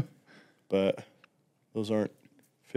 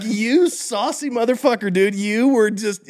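excited; 10 semitones higher, 3 dB louder, slower than usual. Retold or imitated. Delighted mock outrage.

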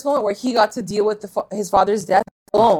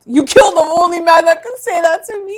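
much higher, louder anguished line; dramatic Archie; 8 semitones higher, 6 dB louder than usual. Retold or imitated. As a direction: ham up the grieving son. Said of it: "Archie was like".

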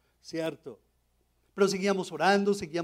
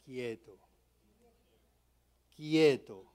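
calm voice of the Lord; 5 semitones lower, slower than usual. Retold or imitated. The Lord's calm inner voice.

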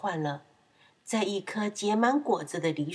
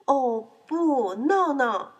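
higher, drawn-out cry of alarm; dismayed outcry; 5 semitones higher, 4 dB louder, much slower than usual. Acted. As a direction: wail it in mock dismay. Refrain of dismay at piglet. (夸张地惊呼)

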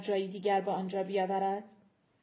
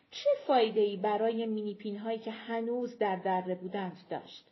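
usual speaking voice

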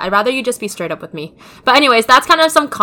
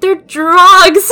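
laughing confession; 8 semitones higher, 7 dB louder than usual. Retold or imitated. Daughter confessing to mom, laughing loudly. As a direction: blurt it out, laughing loud and high.

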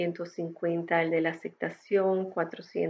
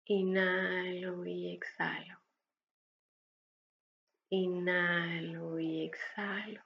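slow, softer breathy whisper; 9 dB quieter, slower than usual. Acted. Breathing cue in meditation. (susurrando despacio)

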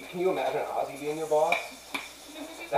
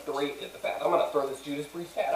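ordinary talk, nothing marked

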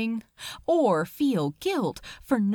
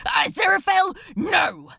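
loud, high cry of protest; anguished shout; 7 semitones higher, 4 dB louder than usual. Acted. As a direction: shout the name in alarm. Crowley protests angel's refusal.

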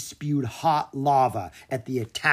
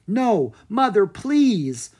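higher, louder begging; pleading; 8 semitones higher, 6 dB louder, much slower than usual. Acted. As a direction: beg her mother not to send him away. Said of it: "Opeli pleaded".

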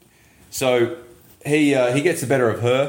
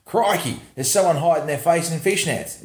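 mock Aussie cop; 5 semitones higher than usual. Imagined cops' reaction.

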